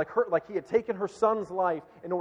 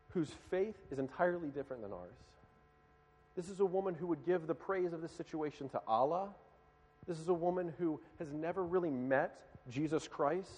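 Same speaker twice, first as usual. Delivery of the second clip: usual speaking voice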